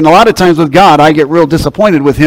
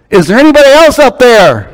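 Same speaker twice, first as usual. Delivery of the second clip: higher call upward, drawn into a falling end; acted calling out; 6 semitones higher than usual. Hiker calls up past God for help, acted calling out. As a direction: call up as if to someone far above. Man calls up for another helper.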